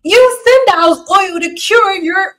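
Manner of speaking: high, loud, hammed-up sales voice; mock product pitch. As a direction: pitch the oil with mock conviction. Parody of a hair product pitch.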